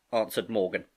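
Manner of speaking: ordinary delivery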